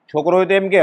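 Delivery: normal talking voice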